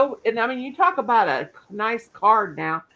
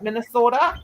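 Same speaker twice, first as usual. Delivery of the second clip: mock Minnesota accent; 2 semitones higher than usual. Teasing a Minnesota friend about how she says it, in a mock Minnesota accent. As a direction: say Minnesota like a Minnesotan, rising at the end. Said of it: "the way you guys say it".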